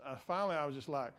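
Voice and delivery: normal talking voice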